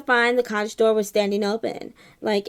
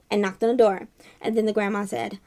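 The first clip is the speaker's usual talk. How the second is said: ordinary delivery, nothing marked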